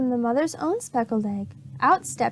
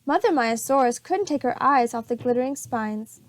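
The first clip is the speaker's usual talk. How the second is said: ordinary delivery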